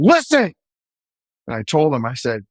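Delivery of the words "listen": officer yelling over a screaming prisoner; yelled command. Retold or imitated. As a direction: cut through his screaming with one word. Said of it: "So I had to yell".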